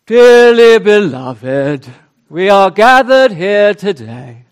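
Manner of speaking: dreary, pompous clergyman, loud and slow; mock a stuffy English bishop; pompous vicar voice. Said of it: "the most dreary and pompous voice".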